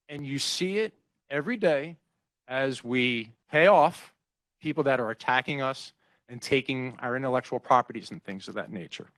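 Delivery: ordinary delivery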